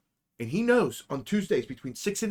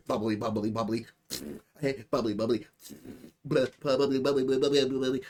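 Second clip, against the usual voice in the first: mock bubbling noises; 3 semitones lower, 3 dB quieter, faster than usual. Imitating toilet noises.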